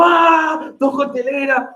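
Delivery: high, drawn-out yell then the order shouted; hammed-up cry of alarm in the rush; mock panic yell. Bartender yells an order at the swamped bar, mock panic.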